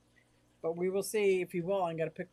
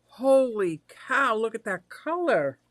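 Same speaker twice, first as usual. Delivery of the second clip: loud, stretched-out marvelling; amazed excitement; 3 semitones higher, 11 dB louder, slower than usual. Real, not acted. Host admires the color of the surprise, amazed. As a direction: exclaim it high and loud, truly amazed. Admiring the picture just texted.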